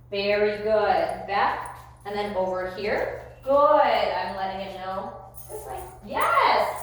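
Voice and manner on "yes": sudden praise. Real, not acted. Praise marker.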